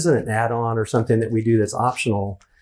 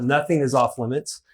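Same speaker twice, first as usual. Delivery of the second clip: ordinary talk, nothing marked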